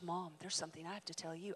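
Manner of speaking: low, quick hushed words; hushed secret. Retold or imitated. Daughter telling her mother a secret, hushed and quick. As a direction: answer with a secret of your own, softly.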